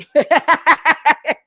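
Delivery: loud high cackle; high burst of laughter. Real, not acted. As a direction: crack up at yourself. Laughing at own bit.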